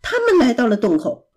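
ordinary delivery, nothing marked

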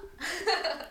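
a sudden high, loud burst of laughter. Real, not acted. Guest laughing at the host's fumbled invitation, high burst of laughter. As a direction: let a real laugh pop out high.

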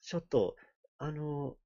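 halting, drawn-out mock-serious Japanese; jokey Japanese. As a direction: improvise Japanese with long hesitations. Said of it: "to talk in Japanese, please".

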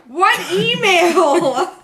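loud, high, long drawn-out disbelief; loud incredulous protest. Real, not acted. Intern told to read an email, loudly incredulous. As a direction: stretch it out, baffled and loud.

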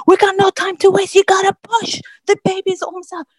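high, pressing commands; urgent doctor. Retold or imitated. Doctor urges mother to push, high and urgent. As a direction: imitate a doctor urging with no time to lose.

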